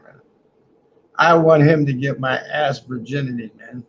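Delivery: normal talking voice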